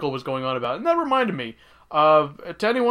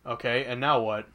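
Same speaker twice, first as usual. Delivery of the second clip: ordinary talk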